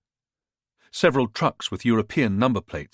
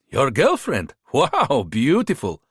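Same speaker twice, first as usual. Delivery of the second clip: put-on higher-pitched, smooth-talking hustler with a foreign accent; charming border fixer; 4 semitones higher, slower than usual. A narrator's reading. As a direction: sell yourself like a friendly tout. Bogdan's held voice.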